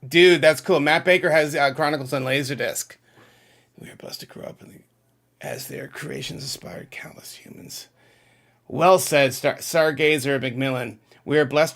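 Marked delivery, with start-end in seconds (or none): 3.8-7.9 reading the comment in a soft whisper; whispered read-through. Real, not acted. Host whispering a viewer's chat comment to himself, hushed. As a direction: mouth the words quietly to yourself first.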